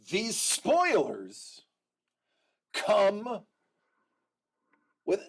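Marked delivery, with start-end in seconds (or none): none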